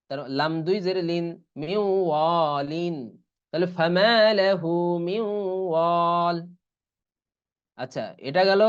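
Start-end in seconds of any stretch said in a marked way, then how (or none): 1.6-2.9 slow, held nasal glide from মিউ into ওয়ালিন; idgham into waw. Shown as a demonstration. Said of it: "তাহলে কিরকম উচ্চারণ হবে". 3.8-6.4 মিন ওয়াল as miw-waal, the nasal glide held; idgham into waw. Shown as a demonstration. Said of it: "তাহলে কিরকম উচ্চারণ হবে".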